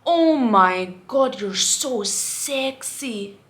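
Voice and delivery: drawn-out, loud mock catcall; imitated catcall shout. Man calls out from car window at woman walking, mock drawn-out shout. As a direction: stretch it out like a heckler, starting loud. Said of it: "shout that out your window".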